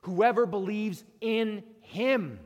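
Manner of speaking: preacher's slow emphatic correction; stressed slow reading. Shown as a demonstration. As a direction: lean hard on each word of "Whoever believes in him". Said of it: "how many times do we read it like that".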